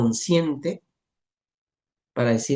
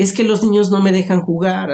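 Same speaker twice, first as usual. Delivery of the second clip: put-on louder, mildly plaintive child's complaint; imitando llanto; 3 semitones higher, 7 dB louder than usual. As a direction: retell a tearful child's protest, voice raised. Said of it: "así llorando". (imitando un poco a un niño que se queja llorando)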